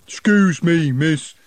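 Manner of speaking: lightly voiced troll; a hint of a slow troll. A narrator's reading. Troll speaks.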